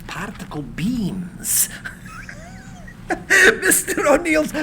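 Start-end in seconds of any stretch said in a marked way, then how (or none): 1.7-3.6 high cackling laugh, far above his usual voice; a gleeful cackle that shoots up high. Acted. Tesla laughs at the biographer.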